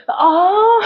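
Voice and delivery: bursting out laughing, high and loud. Real, not acted. Laughing at her own mix-up.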